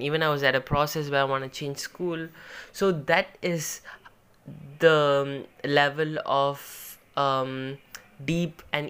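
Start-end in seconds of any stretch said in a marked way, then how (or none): none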